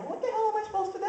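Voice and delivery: high, confused outburst; lost at the drive-up ATM; baffled. Retold or imitated. Confused driver at a Braille ATM, baffled outburst.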